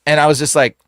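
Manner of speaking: normal talking voice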